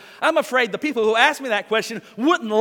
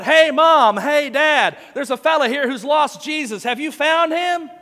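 loud holler to the next room, pitched up; 6 semitones higher, 5 dB louder than usual. Retold or imitated. Pastor hollering to his parents about the lost Jesus seeker. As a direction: call out to Mom and Dad, teasing. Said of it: "I hollered out".

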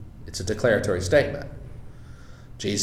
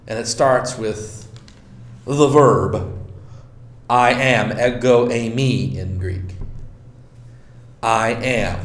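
ordinary delivery

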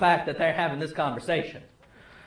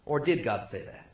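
much lower and softer, insinuating tempter; sly serpent's question; 10 semitones lower, 8 dB quieter than usual. Acted. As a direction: plant the doubt low and quiet like the serpent. Serpent's doubt, repeated aside.

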